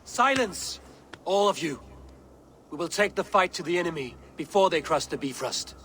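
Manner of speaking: booming low command; commanding roar. Acted. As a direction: silence the room with authority. King silences arguing council.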